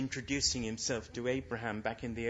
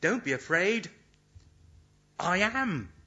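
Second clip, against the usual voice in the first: loud, ringing declaration with I am pitched up; capital letters; 4 semitones higher, 6 dB louder than usual. Acted. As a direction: proclaim it in capitals. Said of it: "simply says in capital letters".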